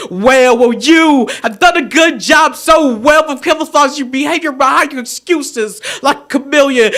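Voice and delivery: usual speaking voice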